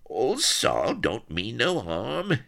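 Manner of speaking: high, slow rustic whine; whining. A narrator's reading. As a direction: whine like a cringing yokel. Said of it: "he whined".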